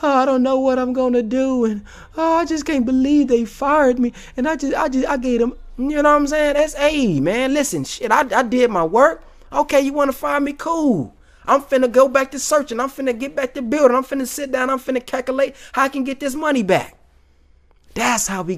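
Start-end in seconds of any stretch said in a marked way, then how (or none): 0.0-4.1 high, whiny, hammed-up sobbing complaint; mock crying lament. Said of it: "making the video crying". 4.3-17.0 upbeat resolve. Acted. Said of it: "acting his own fired response".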